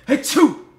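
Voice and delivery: loud high fake sneeze; imitated sneeze. Showing how the worker sneezed on his sandwich, loud fake sneeze. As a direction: act out a big sneeze.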